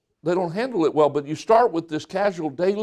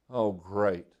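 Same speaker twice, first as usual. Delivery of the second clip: low, quiet, deflated reply; mock a dismayed spouse under your breath; unenthusiastic; 9 semitones lower, 8 dB quieter than usual. Spouse hearing the parents are coming this weekend, unenthusiastic.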